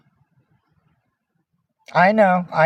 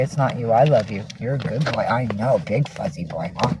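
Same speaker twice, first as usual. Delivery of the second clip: low, tender pet talk; soothing affection; 6 semitones lower, 5 dB quieter than usual. Real, not acted. Owner comforting a fuzzy pet after venting, soothing affection. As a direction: lower your voice and reassure a beloved animal.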